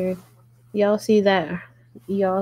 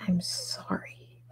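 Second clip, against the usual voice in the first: whispered apology; 11 dB quieter, slower than usual. Real, not acted. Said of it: "apology to viewers".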